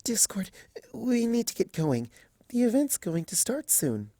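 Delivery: high, soft, hurried hush; shy mare trying to change the subject; flustered Fluttershy. Acted. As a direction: nudge him gently, barely audible. Fluttershy urging gently.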